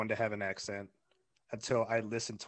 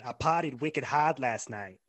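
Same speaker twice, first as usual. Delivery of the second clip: louder, broad Boston accent; party-boasting Bostonian; 2 semitones higher, 5 dB louder than usual. Shown as a demonstration.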